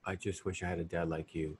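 low, soft dying-wish delivery; tender movie quote. Retold or imitated. Quoting the dying boy's line to Peter, low and tender. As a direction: say it softly and low, almost fading out. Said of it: "He goes".

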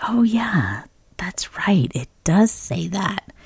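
whispered, breathy realisation; whispered aside. Retold or imitated. Recalling the moment she remembered God's word, in a whisper. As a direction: whisper it to yourself as it dawns on you.

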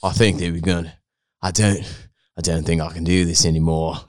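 low British cowboy voice. Acted. Friend having a go at the challenge, British cowboy accent. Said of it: "A British cowboy".

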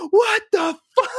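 very high mock scream; imitated scream. Retelling the movie hero after the explosion, mock scream. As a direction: imitate a character screaming in shock. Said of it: "him to just scream".

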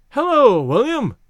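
high, loud hello that swoops; bright-pitched, exclaimed welcome; calling out greeting. A narrator's reading. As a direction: hail someone across the lawn. Bathers spot and greet William.

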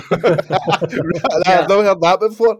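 big laugh, high and slow through the words. Real, not acted. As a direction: crack up as you say it. Laughs at the joke.

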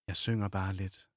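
ordinary talk

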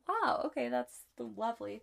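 dry mock-grateful wow, okay; sarcastic thanks. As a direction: thank him with biting sarcasm, pitch jumping up on wow. Sarcastic reply to discouraging brother.

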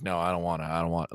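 low, soft mock-nervous refusal; understated imitation of a timid boy; scared, nervous. Retelling first boy refusing mom, mildly nervous. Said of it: "like, all scared and nervous".

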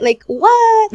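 high, loud incredulous what; shocked disbelief. Acted. Mom scoffing at microwavable food, loud and high. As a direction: throw it out in mock outrage.

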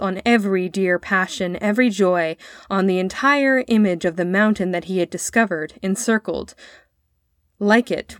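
plain reading voice

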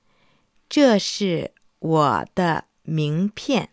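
very slow, word by word; slow teaching demo. Shown as a demonstration. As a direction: spell it out slowly for learners. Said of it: "يمكنك أن تقول".

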